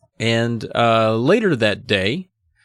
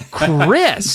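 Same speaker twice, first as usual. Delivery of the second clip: sharp higher shout; mother's shout; 7 semitones higher, 5 dB louder than usual. A narrator's reading. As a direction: scold her daughter. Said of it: "Missus Powell shouted".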